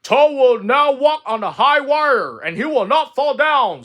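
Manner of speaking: high, loud, grand stage announcer; strange announcer voice. Acted. Strange voice introduces the high-wire act, loud grand announcement. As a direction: proclaim the act to a big theater. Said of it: "said the voice".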